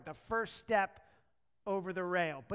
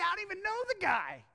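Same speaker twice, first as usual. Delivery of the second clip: high, loud acted protest; emphatic denial; 8 semitones higher, 5 dB louder, faster than usual. Disciple denying Jesus three times, emphatic denial. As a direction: disown him loudly and insistently.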